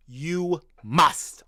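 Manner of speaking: "you" held, then "must" punched; emphasis on MUST. Shown as a demonstration. Streamer warning Arsenal about this season, heavy stress on "must". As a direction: punch "must" with a crisp "st" at the end. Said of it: "Emphasis on the st".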